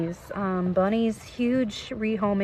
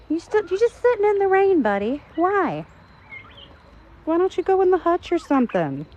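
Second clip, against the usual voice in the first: high teasing scold; 10 semitones higher, 4 dB louder than usual. Real, not acted. Scolding rabbit sitting in rain.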